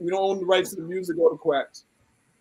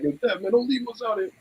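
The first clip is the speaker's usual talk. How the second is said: higher, softer quoted plea; small high imitation of a friend's plea; imitated plea; 5 semitones higher, 6 dB quieter than usual. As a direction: lightly mimic someone pleading, pitched up and quiet. Quotes Mike pleading with Dev.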